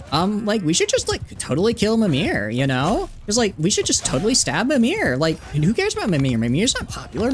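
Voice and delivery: put-on high-pitched Valley Girl mean-girl gossip; Gretchen Wiener. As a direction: swoop up high like a catty teen queen. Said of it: "Gretchen Wiener impression from the hit".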